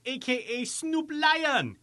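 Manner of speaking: ordinary talk, nothing marked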